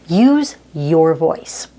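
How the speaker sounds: slow, deliberate "use your voice", each word set apart; measured key advice, stretching "use your voice"; emphatic. Real, not acted. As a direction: land "use" and "your voice" separately, with weight.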